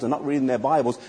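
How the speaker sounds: usual speaking voice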